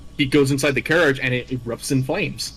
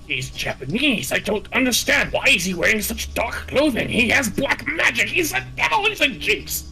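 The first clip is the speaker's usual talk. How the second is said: rising higher and higher in a shrill mocking voice; mock bigoted Brit; 11 semitones higher than usual. Mocking the prejudiced English townsfolk, high and scandalised. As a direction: ham up a scandalised Victorian gent.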